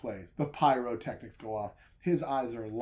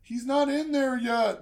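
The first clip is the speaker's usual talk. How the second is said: objecting; 11 semitones higher, 5 dB louder than usual. Retold or imitated. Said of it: "they're like".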